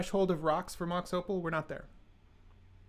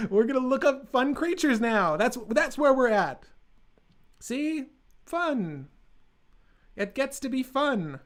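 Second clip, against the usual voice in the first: excited; 7 semitones higher, 5 dB louder than usual. Real, not acted. Hyping up fun creatures for chat.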